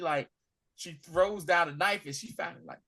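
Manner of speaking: normal talking voice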